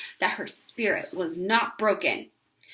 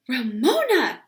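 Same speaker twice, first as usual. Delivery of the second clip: exasperated cry; 3 semitones higher, 6 dB louder, slower than usual. A narrator's reading. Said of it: "thoroughly exasperated".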